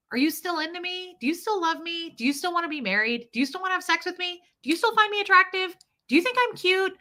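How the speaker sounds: a hint of clingy begging for reassurance; insecure pleading. Wife pressing husband Jeff for reassurance, anxious plea.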